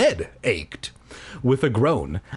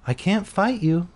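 wearily; 8 semitones higher than usual. Acted. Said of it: "I told him wearily".

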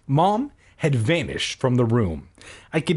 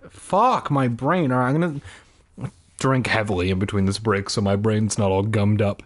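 ordinary delivery

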